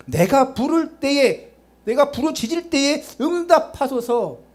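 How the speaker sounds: high, drawn-out plea to God; crying out. Shown as a demonstration. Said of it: "부르짖는 자가 돼야 됩니다". (높고 길게 부르짖으며)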